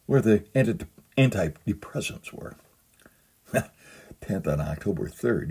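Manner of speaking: ordinary delivery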